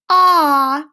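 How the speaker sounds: loud long ah with mouth wide open; doctor's ah. Retold or imitated. The doctor asking for the ah, loud open ah. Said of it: "Open up wide and say".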